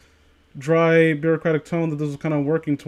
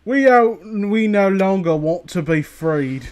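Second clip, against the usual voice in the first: light mock Cockney, starts higher and louder; Cockney accent; 4 semitones higher, 3 dB louder, slower than usual. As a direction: play a Cockney captive, slowing down. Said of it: "Calm voice response".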